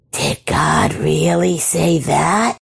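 slow, incredulous snake-like creature; slow serpent voice, scornful surprise; exclaiming creature. A narrator's reading. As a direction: draw out the question in mock disbelief. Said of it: "exclaimed the creature".

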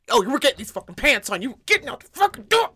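rising, strained, hurried rant; retold frustration. Mom retells forcing pants on daughter, frustrated. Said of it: "I'm so frustrated".

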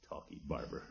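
whispered grumble under breath; muttered aside. Real, not acted. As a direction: mutter it so he can't hear. Grumbles aside about Floyd.

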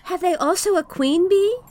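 put-on high, eager child's question; little boy voice. A narrator's reading. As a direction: ask it like a curious small boy. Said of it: "asked the little boy".

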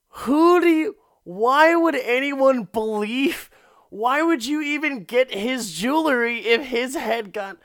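very high, loud squeal of disbelief; incredulous outburst. Acted. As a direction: blow up in hammed-up bafflement, voice way up high. His disbelieving reaction to her excuse.

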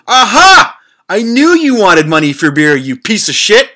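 loud, high gloating aha; triumphant shout. Retold or imitated. Narrator catches the beggar with a beer, loud gleeful gotcha. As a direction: crow at him like you caught him red-handed.

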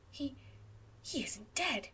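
halting, hushed gasp of shock; breathless surprise. Acted. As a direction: stumble over it in disbelief. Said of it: "gasped Anne".

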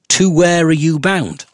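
mocking high, slow posh lilt; sing-song posh accent. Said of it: "a weird sing-song posh accent".

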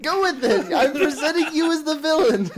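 high, loud laughing yell; laughing shout. Real, not acted. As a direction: shout it while cracking up. Teasing banter, laughing.

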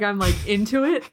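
ordinary delivery

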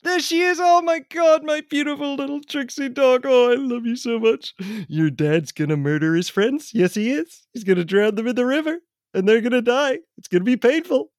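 high, excited doting on a dog; high sing-song baby-talk; gushing pet talk. Acted. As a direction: coo high and sweetly at a beloved pet, even the threats. Greets the returned dog with baby-talk.

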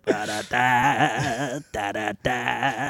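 sultry narrator. Pretend. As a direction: croon it slow like a lullaby. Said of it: "Okay, it's getting creepy".

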